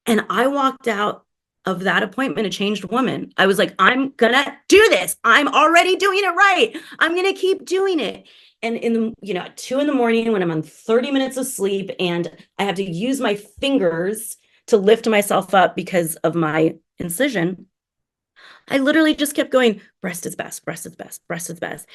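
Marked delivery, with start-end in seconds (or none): 3.8-8.3 high, loud rallying cry; fired-up resolve. Retold or imitated. Retelling her newly fired-up resolve. 20.0-21.9 very soft, hushed repetition; hushed self-encouragement; whispered mantra. Retold or imitated. Repeated mantra to herself at night.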